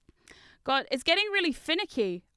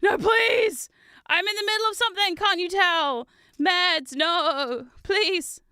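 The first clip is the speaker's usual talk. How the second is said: high, loud begging; mock pleading shout; 7 semitones higher, 5 dB louder, slower than usual. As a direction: plead dramatically with the meds. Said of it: "And I'm like".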